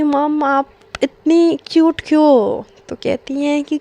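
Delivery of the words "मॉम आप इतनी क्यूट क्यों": higher, louder, slowed cute coo; cute teasing. Retold or imitated. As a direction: coo it sweetly at her mother-in-law. Daughter-in-law teasing mother-in-law sweetly.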